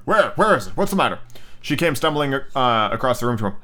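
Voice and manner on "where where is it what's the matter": higher, startled questions; jolted awake, firing questions in alarm. A narrator's reading. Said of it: "He started up in alarm".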